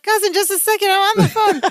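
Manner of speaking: mock Balki telling his cousin to wait; impatient Balki. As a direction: shoo him away mid-call. Balki brushing Larry off.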